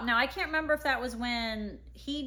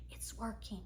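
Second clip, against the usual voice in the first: soft imitated child's whisper, much quieter; imitating whisper; 13 dB quieter than usual. Five-year-old son whispering while healing mommy's foot. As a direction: breathe the words like a child's secret. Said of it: "he starts whispering all this stuff".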